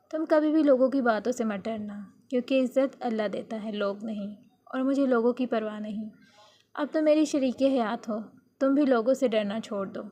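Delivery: plain reading voice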